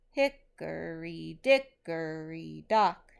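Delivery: slow, heavily stressed reading, each beat marked; slow rhythmic dictation. Shown as a demonstration. Teacher dictating a nursery rhyme for students to mark stresses. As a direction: read it out slowly so students can mark the beats. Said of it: "check with the way that I'm pronouncing it".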